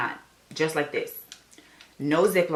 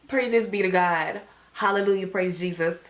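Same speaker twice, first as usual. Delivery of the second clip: jubilant praise; 4 semitones higher than usual. Retold or imitated. Test-taker praising God on finding the sign, jubilant.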